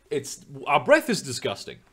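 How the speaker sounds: ordinary delivery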